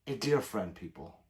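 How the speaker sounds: ordinary talk, nothing marked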